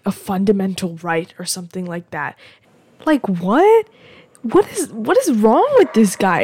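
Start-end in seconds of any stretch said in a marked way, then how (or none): 3.0-6.4 loud, higher-pitched incredulous outburst; appalled disbelief. Real, not acted. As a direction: burst out as if you can't believe it. Appalled at a politician's claim.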